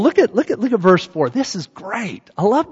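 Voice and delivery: ordinary talk, nothing marked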